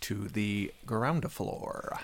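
slow whispered aside; whispered punchline. Pretend. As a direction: whisper it slowly as a deadpan punchline. Whispered punchline of Cockney joke.

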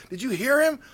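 high, loud imitation of thrilled hosts; excited. Radio hosts repeating the owner's promise, excited. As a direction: gush over the big news. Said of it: "They were so excited".

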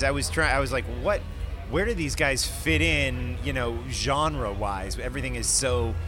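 normal talking voice

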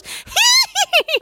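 high, loud mock toy laugh; doll giggle. Mom imitating the doll laughing in the night. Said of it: "and it goes".

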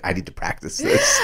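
high, strained raspy character voice; Beetlejuice impression. Co-host recalls rehearsing along with the movie, raspy character voice. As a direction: squeeze it out high in a put-on rasp. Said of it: "do my Beetlejuice impression".

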